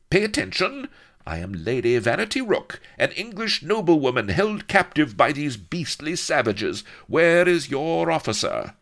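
high, loud, commanding lady; imperious noblewoman. Acted. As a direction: demand obedience as a lady of rank. Haughty noblewoman commands invading soldiers.

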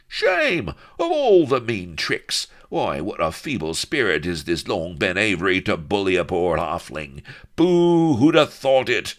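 high, loud cries of mock outrage; jeering roar. A narrator's reading. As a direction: heckle like a rowdy pair of captives. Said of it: "they roared".

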